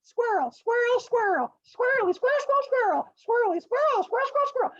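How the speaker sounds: very high, squeaky cheer chant; squirrel cheerleaders. Acted. Squirrel cheerleaders cheering the race, high chant. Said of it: "And they're like".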